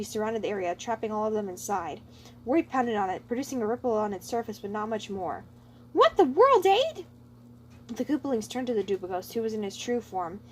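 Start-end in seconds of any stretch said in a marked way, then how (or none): 5.9-7.1 high, loud startled cry; shocked outburst. Acted. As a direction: shout in alarm. Roy trapped in force field.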